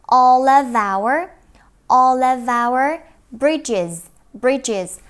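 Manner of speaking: Vietnamese: đọc chậm, to, nối âm; slow loud demo. Shown as a demonstration. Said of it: "loud slow demo".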